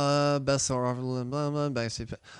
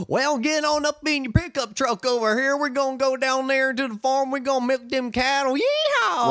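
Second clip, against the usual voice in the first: mock Southern accent; 13 semitones higher, 3 dB louder than usual. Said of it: "when everyone mocks a Southern accent".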